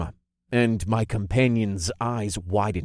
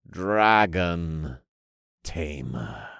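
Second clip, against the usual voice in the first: low, slow reverent pronouncement; intoning cult leader; 5 semitones lower, much slower than usual. A narrator's reading. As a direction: intone it in two solemn, sinking words. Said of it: "the leader of the dragon-worshipping cult intoned".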